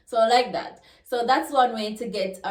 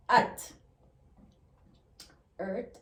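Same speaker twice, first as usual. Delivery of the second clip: ordinary talk, nothing marked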